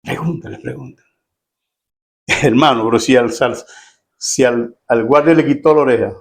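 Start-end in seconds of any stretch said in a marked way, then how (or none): 0.0-1.1 hushed, insistent prompt; acted secretive murmur, fading softer; furtive urging. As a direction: nudge them under your breath. Pedro urging Juan under his breath.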